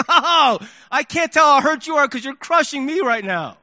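high, pained mock protest; smacked and crushed. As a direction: cry out as if under attack. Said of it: "all I could see was the smacking".

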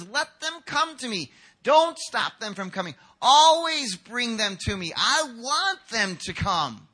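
high, slow, loud proclamation; impassioned urging. Acted. Jesus telling disciples he wants the children, impassioned urging. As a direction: build to a loud, drawn-out plea.

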